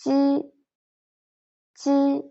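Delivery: ji said crisp and high, twice; pinyin initial ji. Shown as a demonstration. Teacher drills the initial for learners, crisp and clear. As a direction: model the initial ji slowly for the class. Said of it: "So the initial".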